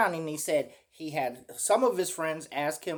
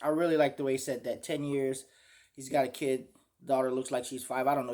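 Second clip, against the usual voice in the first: ordinary delivery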